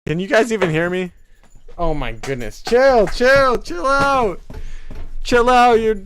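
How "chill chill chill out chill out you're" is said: higher, loud exasperated commands; scolding. Real, not acted. Scolding the puppy wrecking the stream, loud. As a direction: tell the dog to settle down firmly.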